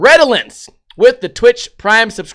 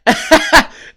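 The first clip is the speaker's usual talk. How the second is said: high laugh; 9 semitones higher, 4 dB louder than usual. Real, not acted. Laughs at the prank.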